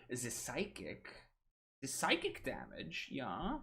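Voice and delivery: silly, hammed-up German accent; bad German accent. Streamer slipping into his game character's voice, bad German accent. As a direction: slip into a deliberately awful German accent. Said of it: "I do the worst German accent".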